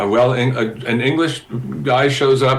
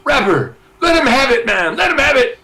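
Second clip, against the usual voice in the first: retold yelling; 10 semitones higher than usual. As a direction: yell it into his ear over the music. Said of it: "Gilmore's yelling in my ear".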